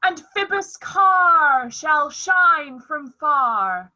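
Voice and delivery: slow, loud booming rant; lofty tyrant ranting. Acted. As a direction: declaim like a raging tyrant. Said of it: "This was lofty".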